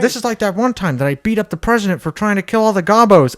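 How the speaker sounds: high, loud, fast cartoon dad; Peter Griffin. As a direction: rattle it off as an excited cartoon oaf. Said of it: "the power of Peter Griffin".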